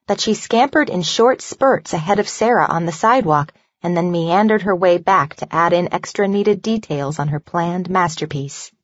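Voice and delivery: usual speaking voice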